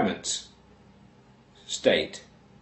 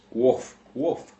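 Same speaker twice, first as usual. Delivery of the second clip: two short mock barks, woof woof; dog bark imitation. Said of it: "and barks twice".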